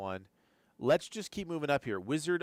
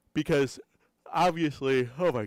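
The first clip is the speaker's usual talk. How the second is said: mid-yawn; 2 semitones higher, 6 dB louder than usual. Real, not acted. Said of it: "I cannot stop yawning".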